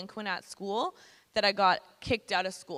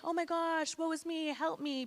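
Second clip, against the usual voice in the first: high-pitched mock helpless plea; mock woe; 8 semitones higher than usual. Speaker showing the victim she wasn't, high mock woe. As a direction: play the poor victim, pitched up. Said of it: "I wasn't like".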